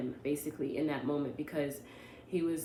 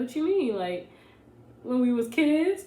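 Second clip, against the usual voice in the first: mock defensive surprise; 7 semitones higher, 6 dB louder than usual. Boyfriend's retold defensive reply.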